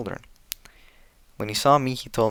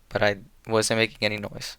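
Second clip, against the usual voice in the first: ordinary delivery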